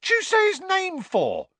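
a very high, shocked squeal; shocked Cockney. A narrator's reading. Stan scolds Harry, very high and shocked. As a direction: squeal it in disbelief.

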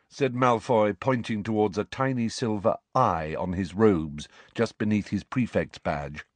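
usual speaking voice

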